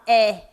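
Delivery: "é" with a wide-open E; open vowel. Shown as a demonstration. Teacher demonstrating the accent's sound, open "é". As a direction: open the mouth on "é". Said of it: "o som está aberto".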